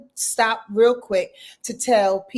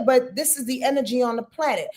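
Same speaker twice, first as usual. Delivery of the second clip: ordinary delivery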